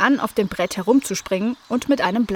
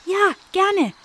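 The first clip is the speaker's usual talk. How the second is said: much higher eager little kitten voice; kitten Cleo; 11 semitones higher than usual. A narrator's reading. Kitten Cleo accepting the invitation, much higher. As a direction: chirp a happy yes. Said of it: "sagte Cleo".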